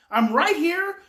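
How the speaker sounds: acted parent, high and forceful; emphatic reassurance. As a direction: insist firmly on being present. Acted parent staying with raging child.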